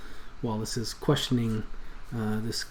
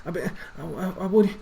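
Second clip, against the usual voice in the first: higher and louder, stammering in panic; stutter, rising to a scared cry; terrified stammer; 8 semitones higher, 5 dB louder, faster than usual. Acted. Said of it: "begins to stammer a bit".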